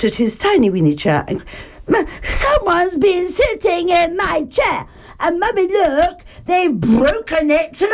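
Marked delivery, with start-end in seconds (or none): 1.9-7.9 high, tearful cub voice; wailing Baby Bear. A narrator's reading. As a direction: break into comic sobs at the end. Baby Bear finds broken chair.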